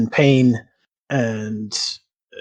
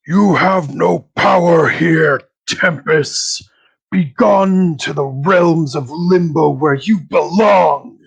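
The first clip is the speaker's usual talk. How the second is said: slow, loud, higher banishing shout; booming priestly condemnation; archpriest yelling; 4 semitones higher, 6 dB louder, slower than usual. Acted. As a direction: cast him out with righteous fury. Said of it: "and yells".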